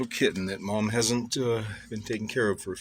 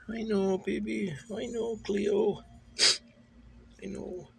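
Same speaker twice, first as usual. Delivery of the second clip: pet talk; 8 semitones higher than usual. Real, not acted. Cooing to kitten.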